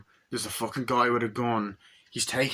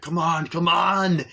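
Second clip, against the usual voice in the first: louder, slow "come on, come on"; mock urging; 6 semitones higher, 6 dB louder, slower than usual. Arnie urging the stolen horse on, loud slow urging. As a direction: spur the horse on.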